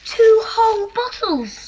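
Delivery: slow, high delighted exclaim; thrilled child; excited. Acted. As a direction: gasp it out in wonder at the gift. Children unpacking hamper, delighted.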